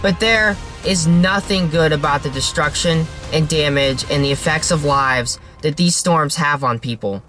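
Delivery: ordinary delivery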